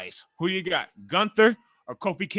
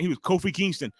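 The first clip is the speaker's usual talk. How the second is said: ordinary talk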